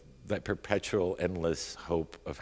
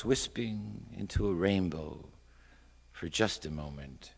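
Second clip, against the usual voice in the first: measured recital, swelling then fading; slow down and let the last words fade away; poem reading; 4 semitones higher, 4 dB louder than usual. A narrator's reading. Poet watching Malta drift past, wistful poem reading.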